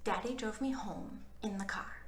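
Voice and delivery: ordinary delivery, nothing marked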